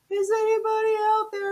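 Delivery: high, thin imitated call for help; distant calling. Imitating survivors heard in the water, calling out. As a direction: call across open water.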